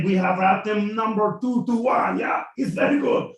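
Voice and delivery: high, shouting hammed-up French officer; French general. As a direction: bark for baguettes, gloating. French general orders baguettes.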